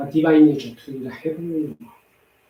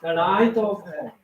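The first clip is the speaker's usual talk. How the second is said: prayer; 5 semitones higher than usual. Acted. Recites a prayer aloud.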